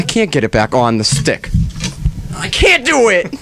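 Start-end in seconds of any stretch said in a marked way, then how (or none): 2.4-3.3 high, strained cry of frustration; exasperated, pitched way up; frustrated outburst. Real, not acted. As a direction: give up in a high-pitched yelp. Frustrated host can't manage the mic.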